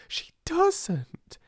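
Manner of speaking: She doesn't! said aloud, high and firm; indignant full-voiced She doesn't!; voiced outburst. A narrator's reading. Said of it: "repeated after whisper".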